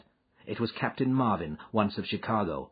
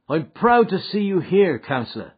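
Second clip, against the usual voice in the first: hearty welcome, higher and louder; 10 semitones higher, 10 dB louder than usual. Acted. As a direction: greet the boss with big hearty cheer. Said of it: "McMurdo answered heartily".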